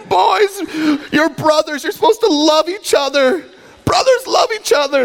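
very high, hammed-up tearful pleading; mock a distraught mother begging her boys; imitated crying mom. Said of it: "she'd be crying".

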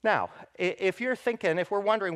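ordinary talk, nothing marked

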